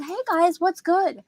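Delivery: chirpy son greeting parents on the phone; imitating son. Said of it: "He said".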